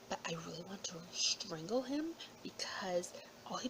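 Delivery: hushed, venomous whisper; angry whisper. Real, not acted. Ranting to viewers about Kodak in a whisper. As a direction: whisper the grudge like a secret.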